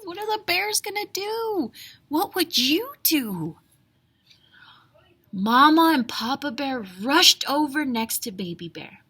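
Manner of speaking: usual speaking voice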